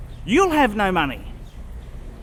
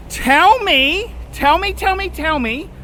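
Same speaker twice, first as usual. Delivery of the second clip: excited plea; 6 semitones higher than usual. Real, not acted. Calling out to viewers, sign-off.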